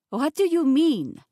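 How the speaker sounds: put-on loud, high French-accented protest; thick French accent. A narrator's reading. Chef with hands on hips to narrator, thick French accent. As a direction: demand an answer as a French chef. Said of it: "in a thick French accent".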